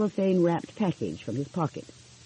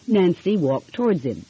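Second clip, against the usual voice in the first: ordinary delivery, nothing marked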